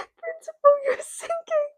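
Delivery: very high, silly little cry; squeaky put-on voice. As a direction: squeal it in a tiny mock voice. Repeats the squeaky put-on line.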